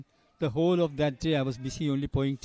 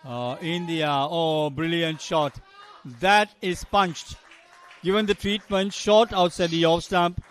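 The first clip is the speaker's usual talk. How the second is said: loud, higher cricket call, drawn out on the shot; live play-by-play; 4 semitones higher, 8 dB louder, slower than usual. Real, not acted. Commentator hailing a brilliant shot, excited play-by-play. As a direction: build into a punched boundary call.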